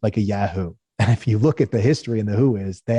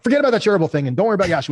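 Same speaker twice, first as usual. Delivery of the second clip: fast, higher-pitched rush; impulsive dismissal; 7 semitones higher, much faster than usual. Retold or imitated. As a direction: breezily wave off the old plan. Founder waving off his previous idea.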